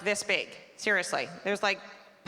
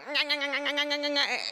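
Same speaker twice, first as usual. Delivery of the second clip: put-on baby chomping noises; 5 semitones higher than usual. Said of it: "it's like".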